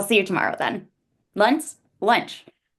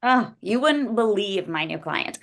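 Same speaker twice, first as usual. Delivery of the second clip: ordinary delivery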